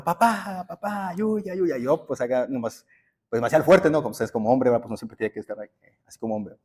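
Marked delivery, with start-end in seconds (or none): 0.0-1.8 high-pitched retold wail for father; imitated child's cry. Father imitates his tied-down son calling for him, high pleading wail. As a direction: plead like a hurting child, without real volume. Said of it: "luego gritaba".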